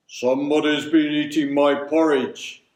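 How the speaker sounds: loud, far-from-natural big bear voice; great deep bear voice. A narrator's reading. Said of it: "he said in a great deep voice".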